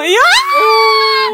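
a long high shriek of joy; fangirl scream. Retold or imitated. Telling her friends, a long high fangirl shriek. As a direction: scream like a fan whose idol just answered.

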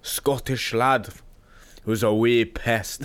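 slow, lightly Scottish; Scottish accent. Pretend. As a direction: drag it out, a Scottish lad teasing. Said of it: "I can do a good Scottish".

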